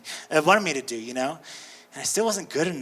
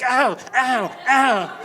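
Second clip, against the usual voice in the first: mock pain; 5 semitones higher, 3 dB louder, much slower than usual. Hitting tombstones on ride.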